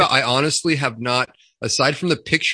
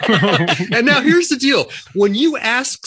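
laughing; 10 semitones higher, 3 dB louder, slower than usual. Real, not acted. Laughing after the punchline.

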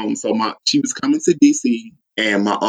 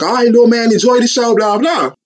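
louder, higher hype-man call; 10 semitones higher, 6 dB louder than usual. Retold or imitated. Voicing [name] to a kid.

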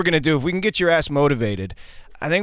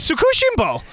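very high, exuberant cry of the name; gleeful high echo; 13 semitones higher than usual. Acted. Host echoes guest's restaurant name in a gleeful high cry. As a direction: squeal the name out with gusto.

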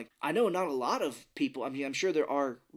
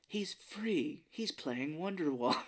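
hushed, breathy mock wonder; slow, hushed awe at a performer; awed onlooker; 4 semitones higher, much slower than usual.

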